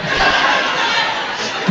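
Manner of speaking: high breathy laugh at the story. Real, not acted. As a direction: break into a high airy laugh.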